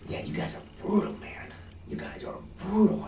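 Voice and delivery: hushed, confiding whisper. Shown as a demonstration. Salesman confiding to a client, hushed whisper. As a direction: lean in and whisper it. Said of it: "I'm gonna say".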